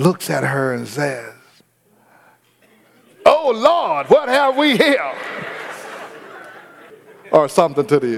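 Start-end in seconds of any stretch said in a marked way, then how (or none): 3.3-5.1 much higher, startled cry; Jacob's stunned high-pitched morning shock; shocked surprise. Acted. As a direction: gasp it out on discovering the wrong bride. Jacob sees Leah in the morning.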